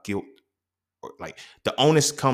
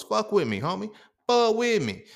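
excited hype; 11 semitones higher than usual. Real, not acted. Hyping up his own point.

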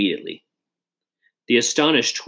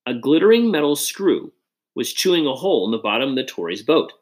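plain reading voice

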